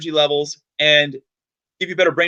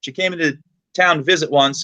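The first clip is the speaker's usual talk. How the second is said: normal talking voice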